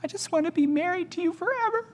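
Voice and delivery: high, soft, far-off mock weeping; imitating tearful wife. Wife in tears to husband, soft high mock weeping. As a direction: play the wife's teary plea. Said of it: "and wept".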